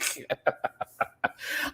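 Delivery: breathless laugh. Real, not acted. Laughing at own story.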